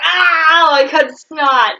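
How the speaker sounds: loud, high mock yell; hammed-up cry of dismay; mock outcry. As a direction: exclaim it loudly, drawing the words out slowly. Acted outcry over cut snot.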